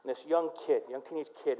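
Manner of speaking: usual speaking voice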